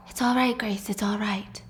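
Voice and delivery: soft, hushed reassurance; calm her down quietly; soothing. A narrator's reading. Husband to his panicking wife in the lobby, soothing.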